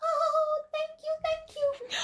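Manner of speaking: very high, cute cartoon voice; sweet as a Disney critter; Disney rabbits. The little rabbits thank their king, in a very high cartoon voice. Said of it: "let me do the Disney effect".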